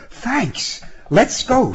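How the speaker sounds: put-on high-pitched, hurried young woman out of breath; panting. A narrator's reading. Woman hopping into the car, panting. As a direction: say it puffed, pitched well up. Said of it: "she panted".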